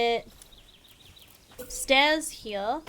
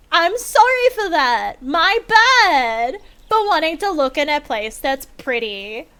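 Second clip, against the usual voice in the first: higher, louder indignant mock sorry; sarcastic apology; 3 semitones higher, 5 dB louder than usual. Real, not acted. Gamer defending her pretty base, sarcastic indignation. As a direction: apologise without meaning a word.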